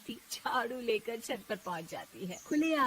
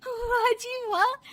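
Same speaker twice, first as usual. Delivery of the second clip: high, drawn-out cheer; gleeful gloating; 12 semitones higher, 7 dB louder, slower than usual. Acted. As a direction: crow slowly in a high voice. Bahu gloats over new power.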